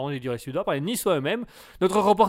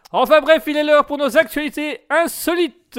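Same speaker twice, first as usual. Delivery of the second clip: radio host; 7 semitones higher, 7 dB louder than usual. Acted. Radio host announcing the news.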